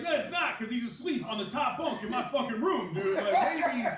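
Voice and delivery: mock yelling back. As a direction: yell back at dad, half laughing. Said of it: "And I was like".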